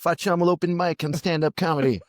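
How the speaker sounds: high, lively Italian voice with English loanwords; mock Italian friend inviting to open mic; playful Italian invite. Voicing an Italian inviting someone.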